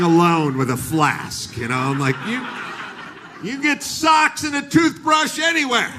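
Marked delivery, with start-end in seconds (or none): 2.3-5.9 much higher, shouting protest; shouted protest about travelling with no luggage; indignant outburst. Retold or imitated. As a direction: blurt it out, fed up. Indignant outburst in security line.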